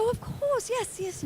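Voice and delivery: put-on high, soft English-accented reply; Patrick Stewart. Actor greeting a remembered co-star, imitated English accent. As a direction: put on his English accent, lightly and politely. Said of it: "And in his accent".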